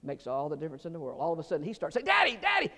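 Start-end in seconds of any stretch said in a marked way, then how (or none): 2.1-2.7 put-on much higher, delighted little boy; excited child calling. Little boy spotting his daddy, calling out excitedly. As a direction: squeal like a kid spotting dad.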